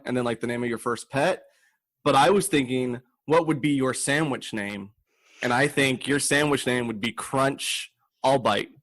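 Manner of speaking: ordinary delivery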